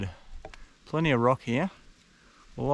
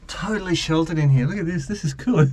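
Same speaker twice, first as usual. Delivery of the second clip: usual speaking voice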